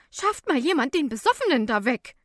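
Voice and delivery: worked-up woman. A narrator's reading. Woman wants drunk man removed, loud and worked up. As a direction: get worked up, calling out angrily. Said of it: "ereifert sich eine Frau".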